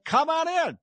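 high, sing-song mock invitation; sarcastic high welcoming call; mock welcome. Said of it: "you were saying".

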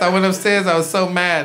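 ordinary delivery

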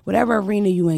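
normal talking voice

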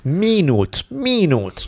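minute said high, syllables stretched; laboured minute. Shown as a demonstration. Italian speaker showing another confusing word, laboured. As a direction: say "minute" twice with a long first vowel. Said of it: "Pronounce that shit".

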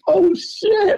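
high, startled "Oh, shit"; a high yelp of retold panic; mock dismay. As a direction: squeak out the shock of scratching the car. Retelling scratching the Porsche.

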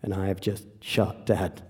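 very low, soft, halting narration; hushed, grave storytelling. Acted. Telling how the shot hit Dad, in a low hush. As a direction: let each moment land quietly, with pauses.

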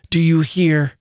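insistent demand. A narrator's reading. Girl repeating herself to a defiant man, insistent demand. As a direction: press him for an answer. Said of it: "she repeated".

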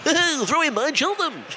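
Chris Tarrant impression. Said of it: "in my Chris Tarrant".

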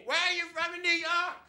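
put-on high-pitched drunk girl yelling; mock screaming. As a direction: scream it as if at someone hard of hearing. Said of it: "she starts screaming".

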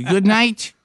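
put-on high little French-accented goodnight. French son answers at bedtime, imitated French accent. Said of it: "you hear this little French accent".

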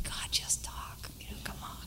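impatient inner voice, whispered; whispered thought. Retold or imitated. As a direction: whisper it like a private, exasperated thought. Silent impatient thought voiced in story.

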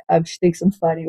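ordinary delivery

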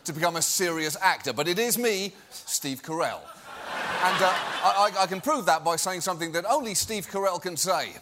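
mild mock British accent; smug faux-British actor. Mocking the feeble-minded coworkers, a mild British accent. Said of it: "that horrible British accent".

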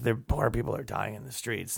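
lightly voiced drunk muttering. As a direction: mutter under your breath, drunk. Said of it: "muttering about poor people".

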